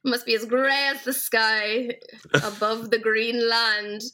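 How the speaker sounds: slow, drawn-out mock pronouncement; drawn out with mock gravity; mock-solemn decree. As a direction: intone it slowly like ancient Irish lore. Mock Irish rule-giving.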